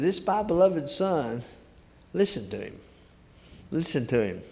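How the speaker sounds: ordinary talk, nothing marked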